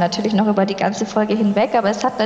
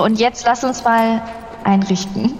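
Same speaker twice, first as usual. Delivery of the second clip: ordinary talk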